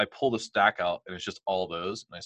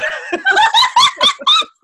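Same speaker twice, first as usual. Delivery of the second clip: peals of high laughter, rising; real laugh breaking out at the memory; 17 dB louder than usual. Real, not acted. Recalling the accountant asking if he was happy, high laugh.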